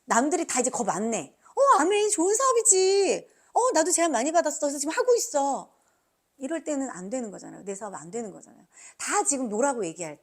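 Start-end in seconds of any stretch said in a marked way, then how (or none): 1.5-5.7 casual acquaintance voice. Retold or imitated. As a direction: play a breezy know-it-all prospect. Acting a casual prospect.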